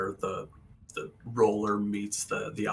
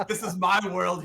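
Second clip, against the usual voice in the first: laughing high refusal; 10 semitones higher, 8 dB louder than usual. Real, not acted. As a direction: refuse while cracking up. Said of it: "changed it to sound like an anime girl".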